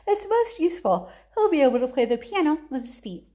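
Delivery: high, fast, sprightly showman; chirpy Wonka. A narrator's reading. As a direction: sell it gleefully. Wonka cheerful rebuttal.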